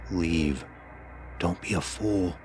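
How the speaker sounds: ordinary delivery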